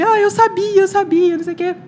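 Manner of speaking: imitated high, loud triumphant outcry; emotional dad. Daughter imitating dad who always knew, overjoyed. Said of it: "Todo emocionado".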